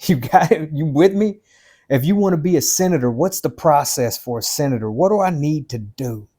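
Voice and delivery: ordinary talk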